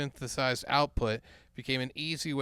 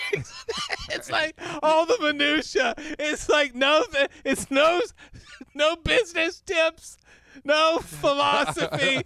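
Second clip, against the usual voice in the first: high, loud shrieking rant; screaming excited; 14 semitones higher, 5 dB louder than usual. Pretend. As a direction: shout it slowly, almost crying with glee. Shrieking book parody.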